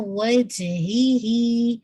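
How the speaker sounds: وجہی very slow, جیم with a slight bounce; slowly, a small echo on the ج of وجہی; light qalqala. Shown as a demonstration. Teacher demonstrating qalqala to the student, slowly. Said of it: "جیم پہ آپ کا ہلکا ... قلقلہ ہوگا".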